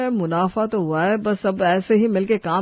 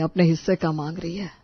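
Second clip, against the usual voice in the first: normal talking voice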